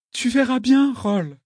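put-on high, tear-filled girl's voice; tearful. A narrator's reading. Girl parting from Roll, voice full of tears. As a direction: let the tears rise into a high plea. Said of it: "d'une voix aussi emplie de larmes".

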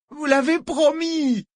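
high, drawn-out wail; whimpering whine. A narrator's reading. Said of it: "gémit Harrod". (gémissement aigu et plaintif)

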